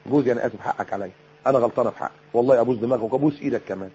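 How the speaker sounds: soft, low, contrite coaxing; humble apology. Acted. Wife going to her husband to say sorry, humble and soft. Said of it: "متكبرة ليه إنك أنت تروح له".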